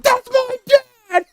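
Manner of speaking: very high, loud, drawn-out squeal; mock proud-son shriek, slow and high; son cheering on dad. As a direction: squeal it like an overexcited son, hammed-up. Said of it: "audience member will be his son going".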